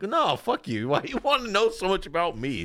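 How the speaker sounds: high-pitched mock defensiveness; indignant caller. Caller arguing with nosy 911 operator, mock indignant.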